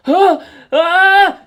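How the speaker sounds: loud high panicked ahh; terrified yells; falling scream. Acted. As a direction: scream as you fall through air. Flinging himself into the wind.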